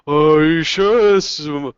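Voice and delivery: slow-witted ogre drawing out the doubt; unsure ogre. Acted. Ogre doubts the goblin about the captive, slow and unsure. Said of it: "The ogre kind of looks around".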